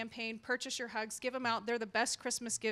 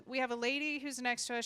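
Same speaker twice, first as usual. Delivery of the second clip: usual speaking voice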